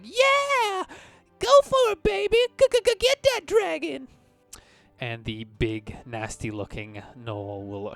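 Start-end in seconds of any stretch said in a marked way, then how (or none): 0.0-4.1 very high, loud cheer; cheering shout. Acted. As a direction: whoop for your team, squeaky high. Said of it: "he kinda cheers for his guys".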